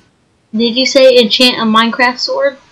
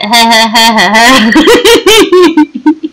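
loud teasing cackle; cackling laugh; 3 dB louder than usual. Real, not acted. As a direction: cackle at the friend. Laughing at own joke.

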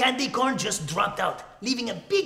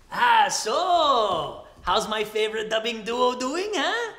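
ordinary delivery

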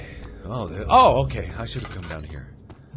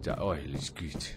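low, soft, slow repeat; silly low echo; 5 semitones lower, 5 dB quieter, slower than usual. Pretend. Player repeating that they like oil, silly low echo. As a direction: drop it down low and quiet, drawn out.

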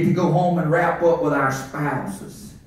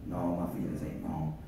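much lower, much softer denial; answering inner voice; 17 dB quieter than usual. Acted. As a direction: answer the doubt almost under the breath. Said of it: "I'm preaching that war that goes on the inside".

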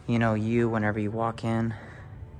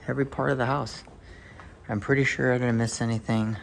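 normal talking voice

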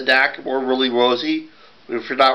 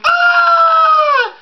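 a high, loud, piercing stage scream; acted scream; 6 dB louder than usual. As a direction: scream as the choking boy. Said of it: "he let a scream".

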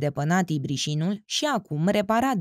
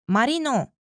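calling out from afar; 6 semitones higher than usual. A narrator's reading. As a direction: shout the name to someone far away. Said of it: "țipă ea de departe". (strigat de departe, mai sus și mai tare)

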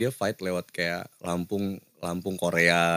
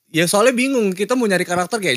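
much higher, louder burst; excited; 12 semitones higher, 7 dB louder than usual. Real, not acted. Host complains excitedly, much higher and louder. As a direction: push it up high with energy.

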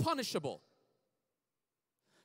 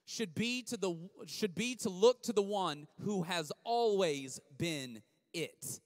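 slow for notes; slower than usual. Shown as a demonstration. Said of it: "Write this down".

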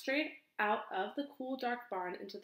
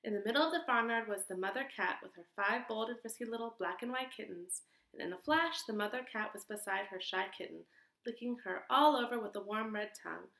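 ordinary delivery

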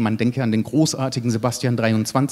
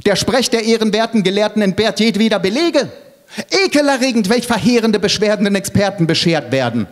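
high, indignant stream of words; agitated rant; 8 semitones higher than usual. Acted. Elke tears into the honourable scholars' speech, agitated. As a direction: rattle out the complaint, pitch up high. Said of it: "entgegnete Elke erregt".

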